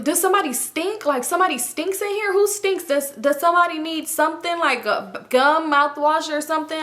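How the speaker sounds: high, amused mock-innocent voice; teasing Clyde. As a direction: play dumb while teasing.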